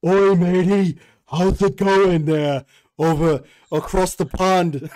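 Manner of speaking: loud, slow put-on cockney mock of a friend; mock British accent. Mocking a British friend on request, loud put-on British accent. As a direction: ham up a British accent at full volume. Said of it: "do your impression on Lucy's voice".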